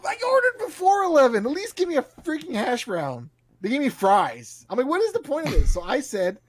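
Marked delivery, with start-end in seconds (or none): 0.0-3.3 high-pitched outraged protest; retelling the hash brown outrage, still worked up; indignant complaint. Retold or imitated. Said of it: "I was super pissed". 4.9-5.7 high exasperated retort. Retold or imitated. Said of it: "super pissed and super bitchy".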